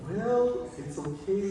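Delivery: ordinary talk, nothing marked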